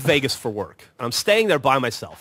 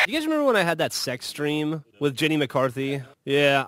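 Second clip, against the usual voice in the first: ordinary talk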